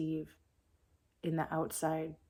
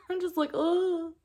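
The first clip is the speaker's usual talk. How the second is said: high, loud giggly squeal; laughing; 14 semitones higher, 6 dB louder than usual. Real, not acted. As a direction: burst into embarrassed giggles. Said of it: "It's making me emotional".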